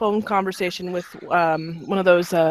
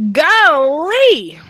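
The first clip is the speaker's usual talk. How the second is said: high, loud Southern "Golly!"; mock Southern exclamation of surprise; exaggerated country surprise; imitating a Southern "golly"; 7 semitones higher, 7 dB louder than usual. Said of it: "their explanation every single time ... was".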